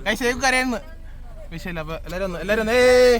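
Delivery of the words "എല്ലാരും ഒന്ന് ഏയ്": rallying cheer. Real, not acted. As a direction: call out to get the group cheering.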